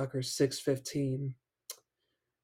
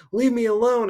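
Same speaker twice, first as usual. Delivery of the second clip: high, loud frightened shriek; 9 semitones higher, 7 dB louder than usual. Acted. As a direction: shriek it in panic, voice cracking upward. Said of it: "I shrieked".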